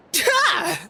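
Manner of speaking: high mock battle cry; punch sound. Describing the Archon's one punch, mock battle cry. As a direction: bark out a mock punch yell. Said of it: "The power in it was like".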